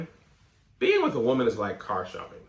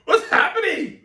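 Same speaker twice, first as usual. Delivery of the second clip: alarmed; 6 dB louder than usual. Real, not acted. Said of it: "I'm coughing, hiccuping, burping".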